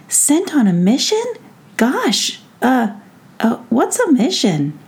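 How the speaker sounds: high-pitched, hesitant questioning; mildly taken aback, fumbling for words; startled. A narrator's reading. Girl hears she has a mission to deal with, startled. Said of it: "Jemmy was startled".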